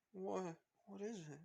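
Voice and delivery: put-on high, soft child voice through tears; tearful little girl. A narrator's reading. Eri asks Izuku, tearful and soft. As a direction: ask timidly while still crying.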